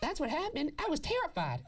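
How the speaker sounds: high mocking voice; mock witness voice. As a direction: keep the squeaky mocking tone. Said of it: "And they went".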